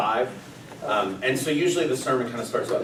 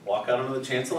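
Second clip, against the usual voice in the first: ordinary delivery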